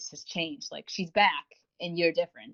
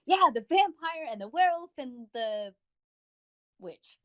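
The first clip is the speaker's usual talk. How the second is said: dismissive afterthought; 7 semitones higher than usual. Retold or imitated. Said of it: "one of those people who were like".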